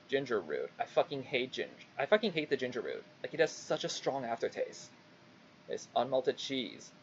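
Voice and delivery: usual speaking voice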